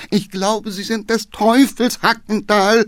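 high, loud exasperated cry; groaning outcry. Acted. Shaken councillor crying out at Hackendahl. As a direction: wail at the reckless driver. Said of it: "stöhnte der Geheimrat hinten".

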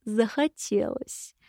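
slow, soft, smiling; grinning. Acted. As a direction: say it with a wide grin. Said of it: "широко улыбнулся мужчина".

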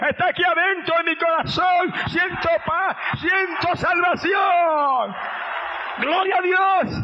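joyful shout. Acted. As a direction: cry out in ecstatic relief. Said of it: "se saca un grito".

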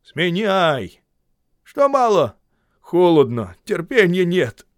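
high, loud gruff shouting. A narrator's reading. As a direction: yell at the horse from below. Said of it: "кричит Губин".

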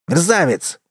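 high yelp of an insult; shrieking insult. A narrator's reading. The boy shrieks an insult at the wolf. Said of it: "взвизгнул парнишка".

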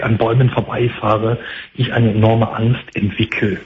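normal talking voice

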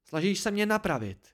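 high, sharp retort; blurted sharply. A narrator's reading. Dena corrects the narrator's claim, blurting sharply. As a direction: cut back at once. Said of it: "vyhrkla ostře".

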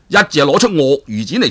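usual speaking voice

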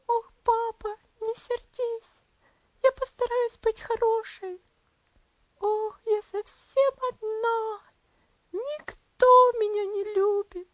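put-on high, loud, slow wailing child; a lonely feverish girl crying for her papa; bitter sobbing plea. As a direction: wail it out inconsolably. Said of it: "заплакала горько и безутешно".

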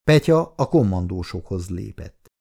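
usual speaking voice

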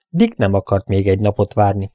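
ordinary delivery, nothing marked

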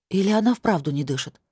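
ordinary delivery

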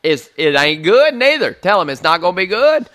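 loud, stumbling blustery holler; retold shouting. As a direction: holler over the call, reenacting it. Shouting in at wife's phone call.

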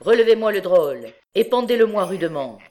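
laut und schnell befehlend; harsh command. A narrator's reading. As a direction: den Gefangenen laut und zügig aburteilen. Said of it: "la voix de Trouillefou qui disait".

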